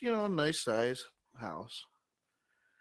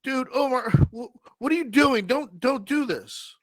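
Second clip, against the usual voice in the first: much higher, louder panicked crying plea; George pleading; 11 semitones higher, 8 dB louder than usual. Acted. Mounted head George begging Omar, high and crying. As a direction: beg in a frantic whine. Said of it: "the head looks down at Omar and goes".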